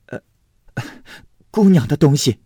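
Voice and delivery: 心虚的母亲，高声; flustered mother. Acted. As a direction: stammer out the excuse, pitched high.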